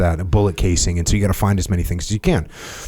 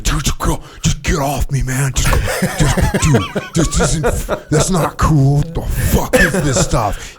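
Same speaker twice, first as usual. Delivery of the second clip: higher, laughing imitation of a panicked teammate; mock angry pleading; 6 semitones higher than usual. Retelling Scott pinned in jiu-jitsu, mock angry whine. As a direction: whine get off me through laughter.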